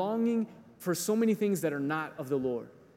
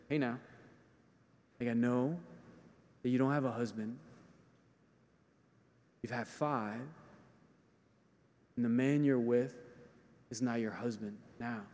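gentle Jesus; 4 semitones lower, 4 dB quieter than usual. Retold or imitated. Jesus tenderly confronting the woman about her husbands, soft. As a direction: lower and softer, gently naming the truth.

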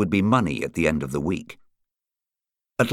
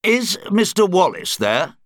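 raised, lightly gruff caller voice; strong, gruff; 11 semitones higher than usual. A narrator's reading. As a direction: ask firmly, with a touch of gruffness. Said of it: "a rather gruff voice".

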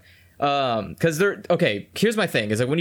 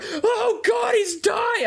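high, hammed-up wailing sob; mock crying wail; much slower than usual. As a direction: ham up a family sobbing over the dog. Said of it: "the choir crying in the background".

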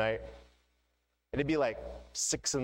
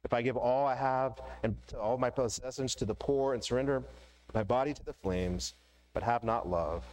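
scripture reading. Acted. Pastor reading First Corinthians to the congregation, solemn. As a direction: read it solemnly, letting pauses land.

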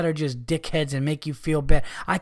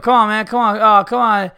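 loud, high pushy carnie whine; hammed-up carnie come-on, louder and higher; mocking carnie; 5 semitones higher, 5 dB louder than usual. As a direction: mimic the nagging hustler. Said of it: "Guy was pissing me off".

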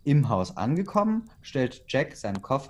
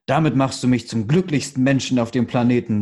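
louder, overjoyed declaration; grinning; 6 dB louder than usual. A narrator's reading. Father-to-be hearing of the pregnancy, grinning joy. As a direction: declare it happily through a grin. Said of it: "sagte er grinsend".

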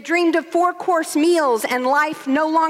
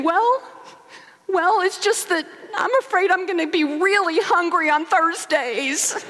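put-on higher, small child's worried voice; a nearly seven-year-old trying to be brave; little boy; 6 semitones higher than usual. Son tells his parents his fear, in a small boy's higher voice. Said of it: "he was saying".

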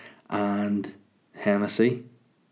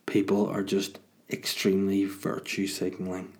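usual speaking voice

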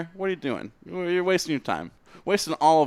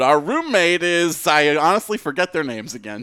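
normal talking voice

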